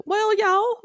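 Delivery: high-pitched Southern impression; squeaky-high country-star accent. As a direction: pitch it way up with a twang. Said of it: "It's too high pitched".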